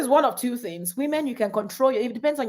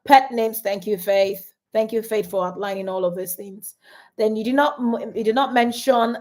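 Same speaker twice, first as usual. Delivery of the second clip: ordinary talk, nothing marked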